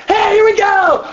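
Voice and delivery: shouted high hype call to the room; leader's call. Retold or imitated. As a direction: yell it out like a youth leader kicking off a song. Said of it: "you hear me going".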